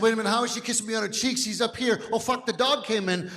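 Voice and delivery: rushed, quick thinking aloud; fast realization. Retold or imitated. Husband realizing the dog licks him, rushed. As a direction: blurt it out fast as it dawns. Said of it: "And I said".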